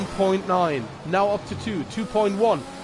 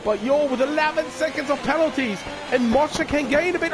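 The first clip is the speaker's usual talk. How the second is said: motorsport commentator; 9 semitones higher than usual. Real, not acted. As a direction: call the final lap at full energy. Final lap commentary, high energy.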